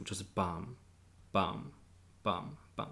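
slow, soft, even bam bam bam bam; imitating even notes. Shown as a demonstration. Showing the pianist's flat sixteenths, slow even bams. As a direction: tap out each note the same, mechanically. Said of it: "都是十六分音符".

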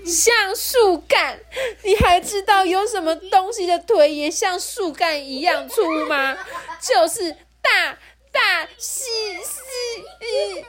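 very high, drawn-out bawling; crying. Acted. Rourou is insulted about his legs and bawls. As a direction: wail until the last word breaks up. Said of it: "柔柔又哭了".

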